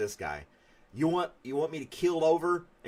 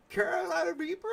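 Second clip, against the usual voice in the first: much higher put-on voice of the pepper eater; high mock pepper guy. Imitating the New Zealand chili oil guy for chat, much higher. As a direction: squeak the name like the chili guy. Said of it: "And he talks like that".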